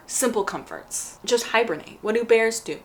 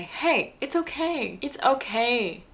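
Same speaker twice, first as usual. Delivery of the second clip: gentle reassurance; 6 semitones higher, 5 dB quieter, slower than usual. Pretend. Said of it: "the best thing you could do is say".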